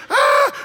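high frightened scream; panicked scream. Retold or imitated. A disciple screaming as the boat fills, terrified. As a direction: shriek in terror.

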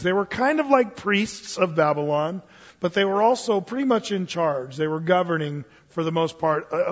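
ordinary talk

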